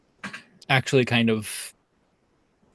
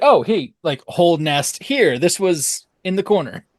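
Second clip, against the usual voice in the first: excited owl voice; 6 semitones higher, 5 dB louder than usual. Retold or imitated. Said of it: "It's just like".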